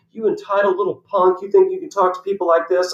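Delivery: high-pitched imitation of an indignant telling-off; mock scolding. Parking attendant telling off the player, mock scolding. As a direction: scold him as the outraged attendant, retold.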